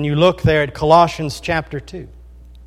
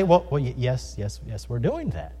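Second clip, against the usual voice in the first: imitating a stammered, sheepish yes, softer and lower; hesitant admission; 7 semitones lower, 8 dB quieter than usual. Preacher voices people cornered on Sabbath work, hesitant admission. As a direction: trail into a quiet, reluctant mock admission. Imagined opponents answer awkward question.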